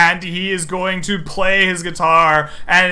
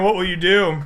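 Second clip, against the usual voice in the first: ordinary talk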